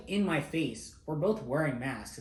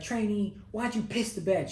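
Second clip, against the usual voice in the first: much higher, louder imitated instructor taunt; mock instructor yell; 9 semitones higher, 5 dB louder than usual. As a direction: bark the accusation like a drill instructor. MTI mocking recruit in dorm.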